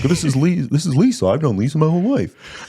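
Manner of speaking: a hint of a goofy moose character; dopey moose voice. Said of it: "your Tom voice is the moose voice".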